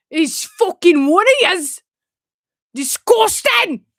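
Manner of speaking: loud, slow, high Scottish outrage; Scottish accent. Acted. Repeating the old Vine's lines, shouted Scottish accent. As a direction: shout it slowly in broad Scottish. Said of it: "why I say it like that".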